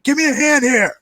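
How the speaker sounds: high, loud strained call for help; struggling pilot. Acted. Pilot struggling with the controls asks Nick for help. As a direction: call for help over the noise. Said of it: "he's like".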